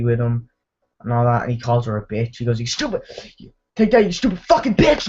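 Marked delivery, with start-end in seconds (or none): 2.6-5.1 high, strained retold tirade; mock furious insult. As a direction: spit out the insult in a raised pitch. Acts out insult at girlfriend.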